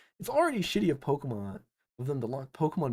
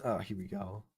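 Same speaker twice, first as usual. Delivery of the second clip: ordinary delivery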